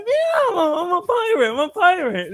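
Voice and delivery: excited, squealing cleft-palate imitation; hare-lipped little boy. Boy glad the lady understood him, hare-lip voice. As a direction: burst out very high as a hare-lipped kid. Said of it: "I'm gonna tell the joke in hair lip".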